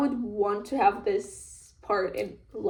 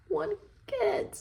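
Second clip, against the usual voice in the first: put-on high-pitched girlfriend voice; 9 semitones higher, 6 dB quieter than usual. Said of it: "She's like".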